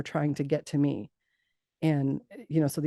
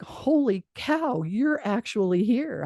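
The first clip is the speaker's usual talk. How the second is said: high, slow, drawn-out amazement; retold astonishment; 7 semitones higher, slower than usual. Granddaughter meeting her dead grandmother, astonished. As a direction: let each word sink in with wonder.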